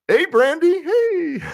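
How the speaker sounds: squeaky high hey Brandy with a laugh; giggly high-pitched hello; playful high greeting. Acted. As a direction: sing out the name in a falsetto. Greeting viewer in put-on voice.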